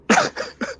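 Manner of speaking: squeaky high laugh; high burst of laughter. Real, not acted. Host laughing at his own joke, high burst of laughter. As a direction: cackle at your own joke.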